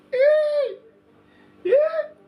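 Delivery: very high, loud whooping yeah; mock delighted squeal. Passenger enjoying a ride in his car, mock delighted squeal. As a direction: squeal it like you're loving the bass.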